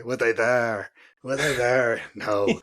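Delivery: strong put-on Irish accent, teacher impression. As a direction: rhetorical question in a heavy Irish accent. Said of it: "As he would say in a strong Irish accent".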